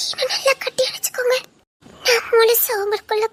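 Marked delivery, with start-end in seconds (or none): 0.1-1.4 crying whisper, pitched higher; tearful whisper. Acted. Woman begging her lover to hold her tighter, tearful whisper. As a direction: plead through tears, barely voiced.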